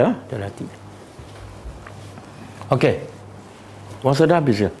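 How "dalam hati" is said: much softer, almost to oneself, as if kept in the heart; said under the breath. Shown as a demonstration. Teacher shows students how to keep it inside, very soft. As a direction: drop the voice to show words kept inside. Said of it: "Dalam hati je".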